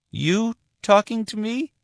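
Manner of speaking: put-on a tiny child's cheeky catchphrase; toddler voice. Little Amy teasing her mother on the phone, high playful toddler voice.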